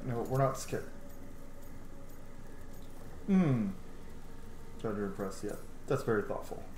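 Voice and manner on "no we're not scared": normal talking voice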